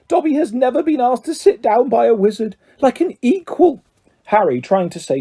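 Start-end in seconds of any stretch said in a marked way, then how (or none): 0.1-3.8 high, fluttering elf voice; a small creature overjoyed to meet his hero; eager house elf. A narrator's reading. Elf meeting Harry Potter at last, high and eager.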